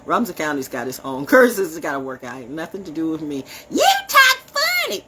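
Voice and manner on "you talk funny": very high, loud, stretched-out mimicry; mock them in a slow squeal; mocking local voice. Imitating Robinson County folks teasing her, mocking. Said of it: "That's what they will always use".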